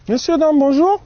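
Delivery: bigger, brighter hello. Acted. Repeats greeting to wake passengers.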